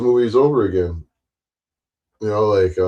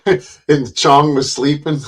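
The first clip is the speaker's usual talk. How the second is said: ordinary talk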